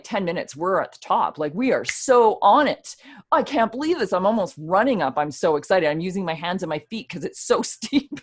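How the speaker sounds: loud, high, bubbling with excitement; thrilled, almost running up the slope; excited. Retold or imitated. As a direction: gush like you're nearly at the summit. Retelling excited climb.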